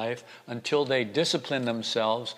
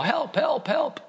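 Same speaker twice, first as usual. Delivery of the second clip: yelling for help; slower than usual. A narrator's reading. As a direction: shout up the cliff, desperate. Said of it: "So he starts to yell".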